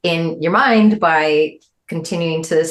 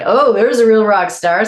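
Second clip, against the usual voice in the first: louder, higher rock star greeting; 6 semitones higher, 6 dB louder than usual. Retold or imitated. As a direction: hail her like a star backstage. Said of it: "[name] was like".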